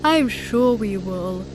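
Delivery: lightly voiced snorting dad, a bit slow; light snort through the words; snorting Daddy Pig. A narrator's reading. Daddy Pig snorts his reassurance to Peppa. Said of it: "snorts Daddy Pig".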